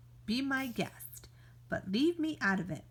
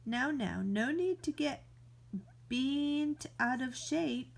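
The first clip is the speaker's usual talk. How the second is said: ordinary talk, nothing marked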